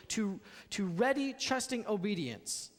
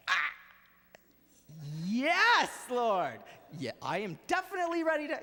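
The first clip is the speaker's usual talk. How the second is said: startled answer; 3 dB louder than usual. Acted. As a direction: sputter out a flustered yes. Startled Abraham answers angel.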